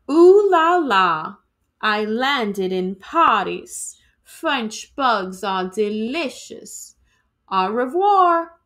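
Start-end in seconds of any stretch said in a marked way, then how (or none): 0.1-1.4 higher, louder French ooh la la; mock French flourish. Grandpa writes home from Paris with a hammed-up French flourish. 7.5-8.5 higher, louder French au revoir; mock French goodbye. Grandpa signs off his postcard with a hammed-up French goodbye.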